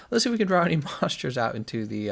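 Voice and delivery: laughing through the words. Real, not acted. Streamer laughing at himself while hunting, laughing. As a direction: giggle while you say it.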